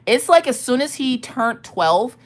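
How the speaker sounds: ordinary delivery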